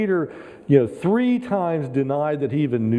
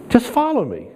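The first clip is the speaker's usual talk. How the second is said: higher, stressing follow; emphatic repeat; 10 semitones higher than usual. Shown as a demonstration. Preacher repeating Jesus' words to the church, lifted and firm. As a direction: lift the line and lean on follow.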